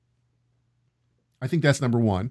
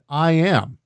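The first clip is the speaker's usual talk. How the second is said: higher, louder proclamation of I am; solemn declaration; 6 semitones higher, 5 dB louder than usual. Retold or imitated. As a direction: declare it like a verdict. Quoting Yeshua's bold declaration.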